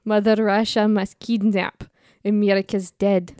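hammed-up Russian accent. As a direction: grope through a mock Russian accent. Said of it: "do the Russian accent".

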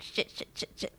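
whispered panicked swearing. Retold or imitated. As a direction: hiss it out in a panic. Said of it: "she's like".

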